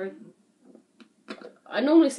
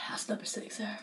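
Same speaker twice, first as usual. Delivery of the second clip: whispering; 15 dB quieter than usual. Real, not acted. Counting quietly during the challenge.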